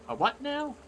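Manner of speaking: hammed-up puzzled query; baffled reply. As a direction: play a confused listener, pitch leaping up. Acted baffled reply to quest giver.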